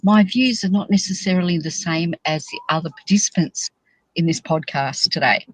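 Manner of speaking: low, formal small-print voice; disclaimer read. Pretend. Podcast host giving the disclaimer to listeners, low and formal. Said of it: "I should do the disclaimer".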